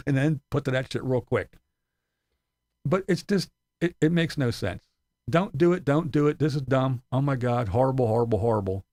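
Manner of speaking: normal talking voice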